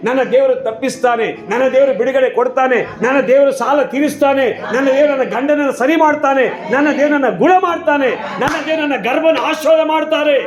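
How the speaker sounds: rising chant-like litany of declarations; impassioned preaching. Real, not acted. Preacher rallies the congregation with God's promises, impassioned preaching.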